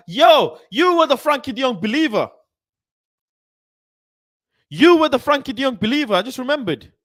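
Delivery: high, loud burst of sudden recognition; excited shouting. Real, not acted. Streamer suddenly recognizing a viewer in chat, excited shouting. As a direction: call someone out at the top of your voice.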